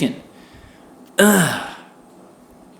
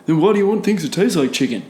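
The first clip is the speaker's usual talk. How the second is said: higher, put-on bro voice; smug questioning bro, pitched up; mocking a critic; 6 semitones higher than usual. Imitating a critic asking why vegan food tastes like chicken, pitched up. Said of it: "quoting critics".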